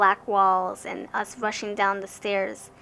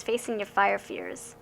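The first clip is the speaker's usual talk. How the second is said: normal talking voice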